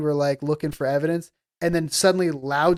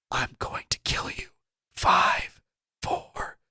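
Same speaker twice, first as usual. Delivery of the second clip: whispered menacing countdown; eerie whispered count; ghost whisper countdown; 3 semitones lower, 4 dB quieter, slower than usual. Retold or imitated. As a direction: whisper the threat and count down slowly. Retelling ghost's countdown threat.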